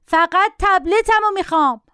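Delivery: a hint of a yelling boy; mild mock yell. A narrator's reading. Boy yells for his tablet, mild mock yell. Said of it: "داد زد".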